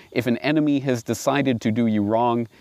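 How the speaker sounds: ordinary talk, nothing marked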